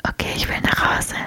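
soft unvoiced whisper. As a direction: whisper it, tired. Whispered skit.

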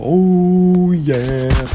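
high crooned oh yeah; smooth oh yeah. Retold or imitated. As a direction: croon it after the jingle. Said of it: "you go".

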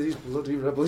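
ordinary talk, nothing marked

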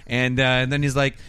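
usual speaking voice

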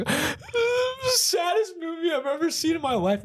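very high-pitched crying wail; hammed-up tearful retelling of kids sobbing; mock sobbing. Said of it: "we're sobbing".

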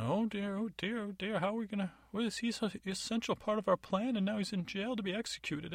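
high, fretful squeal of a worried pig; a dithering fairy godmother stuck as a pig; fussing fairy godmother as a pig. Acted. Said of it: "She's like".

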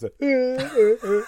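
high, loud fake sobbing; drawn-out, hammed-up wails in imitation; mock crying. Imitating the snake keeper crying after losing his snake, mock sobs. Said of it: "his snake keeper just crying afterwards".

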